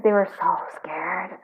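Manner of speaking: unvoiced whisper; hushed confiding whisper. Real, not acted. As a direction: lean in and whisper it. Confiding reading to client.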